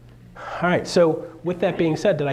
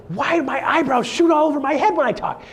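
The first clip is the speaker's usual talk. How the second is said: bewildered; 11 semitones higher, 3 dB louder than usual. Retold or imitated. Asking his wife, retold.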